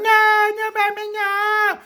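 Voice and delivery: very high, loud drawn-out wail; wailed protest. Acted. Fired clown begging, high loud mock wail. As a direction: howl it in mock despair.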